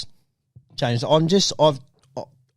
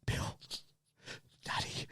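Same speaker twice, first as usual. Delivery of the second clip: hushed, barely voiced tenderness; tender whisper; 20 dB quieter than usual. Son whispers love back to his dad. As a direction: whisper it close and soft.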